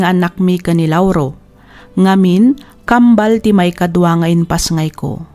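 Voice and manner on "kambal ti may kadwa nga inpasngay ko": ordinary talk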